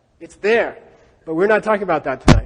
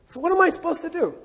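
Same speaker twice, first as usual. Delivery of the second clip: mock exasperated student; 10 semitones higher, faster than usual. Voicing a confused student.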